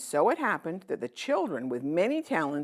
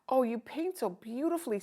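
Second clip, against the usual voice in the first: put-on high, admiring little girl voice; 9 semitones higher than usual. Little girl admiring her sister's sunset, high and sweet. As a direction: gush over her sister's painting. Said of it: "said the little girl who had".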